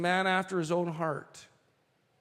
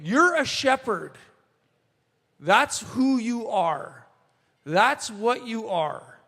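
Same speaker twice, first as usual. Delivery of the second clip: slow and higher, talking down to him; belittling brother; 4 semitones higher, 3 dB louder, slower than usual. Retold or imitated. Older brother putting David down, slow and mocking. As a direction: spell out his place, slowly. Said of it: "saying to David".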